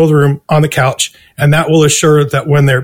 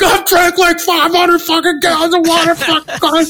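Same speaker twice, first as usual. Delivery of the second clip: much higher, desperate tripping voice; panicked high whine; 12 semitones higher than usual. Retold or imitated. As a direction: whine it out, completely freaked. Panicked reply to publicist.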